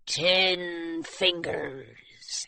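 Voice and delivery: low, very slow pirate growl; menacing pirate. Acted. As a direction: drag it out like a threat. Menacing gold-toothed pirate.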